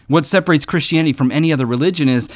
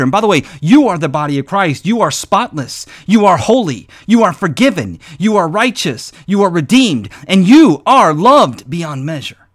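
louder, punched preaching on each you; building declaration; 3 semitones higher, 5 dB louder than usual. Acted. Preacher telling the congregation who they are, building. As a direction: build it, one claim after another.

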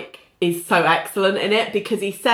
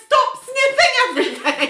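high-pitched, exasperated scolding; exasperated mock yell; 13 semitones higher than usual. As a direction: scold in a high, put-on outburst. Retells own exasperated yell at show.

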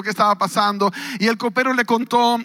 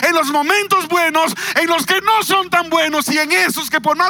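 preaching at full force; 4 semitones higher than usual. Acted. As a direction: preach it out, building to shouts. (predicación a todo pulmón, cada vez más alta)

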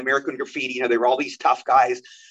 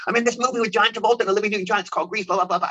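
fast, high, gushing rush of news; excited bragging; 6 semitones higher, faster than usual. Retold or imitated. Young actor telling mom and dad he's in Grease, breathless excitement. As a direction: blurt it out like you can't believe it. Telling family he got the part.

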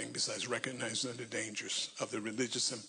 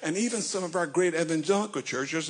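preaching; 2 semitones higher, 4 dB louder than usual. Real, not acted. Preaching at full force.

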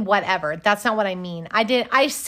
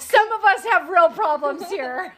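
mock indignant; 9 semitones higher, 6 dB louder than usual. Mock complaint, stressed some.

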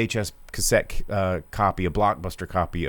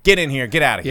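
louder, higher, hurried herding call; mock brusque ordering; 4 semitones higher, 5 dB louder, faster than usual. Host imitating staff at meet-and-greet line, brusque ordering. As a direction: usher people through in a mock bark. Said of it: "They were just like".

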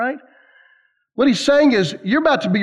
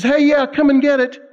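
playful taunt; 6 semitones higher than usual. Retold or imitated. Spider-Man teasing the bully with the ball, playful taunt. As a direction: dare him to come take it.

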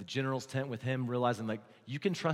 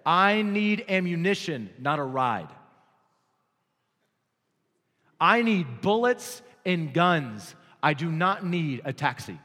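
loud, slow, firm refusal; Zelensky's words turned down the US offer, declared loudly; defiant quote; 6 dB louder than usual. Retold or imitated. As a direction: hammer each demand home. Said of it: "I quote".

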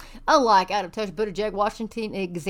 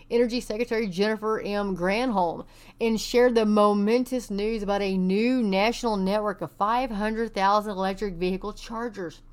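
usual speaking voice

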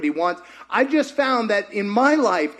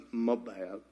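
very low, soft little apology; sheepish admission; 11 semitones lower, 13 dB quieter than usual. Acted. Elijah finally coming out of the cave, sheepish. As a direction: mumble a small confession. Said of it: "he finally goes out and goes".

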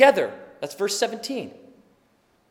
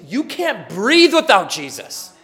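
usual speaking voice

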